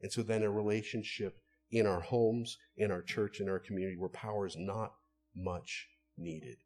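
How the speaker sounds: normal talking voice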